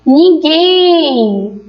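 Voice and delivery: Ninguém with GUÉM drawn out long; calling out the word. Shown as a demonstration. Teacher showing oxítonas, calling Ninguém with long GUÉM. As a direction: call Ninguém, pulling on the last syllable GUÉM. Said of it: "Observe quando eu chamo as palavras".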